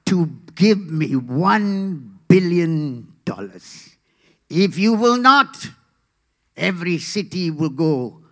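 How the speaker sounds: slow, menacing Russian villain. Acted. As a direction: deliver threats slowly, punching key words loudly. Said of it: "the villain from Russia, Boris".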